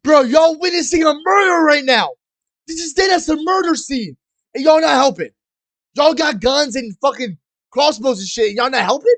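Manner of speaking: loud, high-pitched outraged yelling; indignant shouting. Real, not acted. Streamer scolding witnesses of a murder for not helping, indignant shouting. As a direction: yell at bystanders who won't step in.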